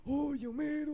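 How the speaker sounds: put-on very high, whiny old man; shrill old man voice. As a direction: squeal it like a fussy grandpa. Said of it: "y ahora como que es".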